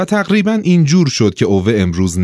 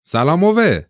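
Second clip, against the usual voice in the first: high-pitched, chummy hello; friendly greeting; 9 semitones higher than usual. A narrator's reading. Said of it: "دوستانه گفت". (سلام دوستانه با صدای زیر)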